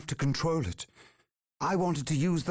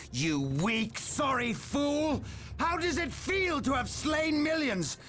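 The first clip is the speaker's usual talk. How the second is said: furious shout; 13 semitones higher than usual. Acted. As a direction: hurl each question at him in rage. Enraged villain confronting his brother.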